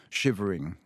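plain reading voice

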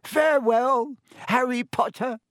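grateful elf bids Harry farewell. A narrator's reading. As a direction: call out a heartfelt goodbye. Elf's farewell.

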